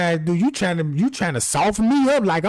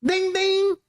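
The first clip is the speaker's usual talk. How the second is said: imitated alarm chime; 9 semitones higher than usual.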